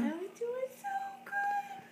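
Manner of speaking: put-on high slow baby voice. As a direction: speak for the baby, squeaky. Voicing baby's pleasure.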